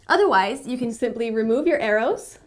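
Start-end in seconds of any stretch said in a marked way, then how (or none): none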